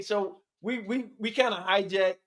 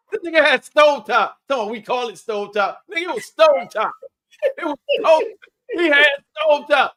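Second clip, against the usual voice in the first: loud, high teasing breaking into laughter; incredulous, raised-voice roasting; mocking disbelief; 6 semitones higher, 8 dB louder than usual. Real, not acted. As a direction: ridicule a friend, cracking up. Teasing a friend for stovetop stuffing.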